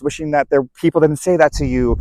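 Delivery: ordinary talk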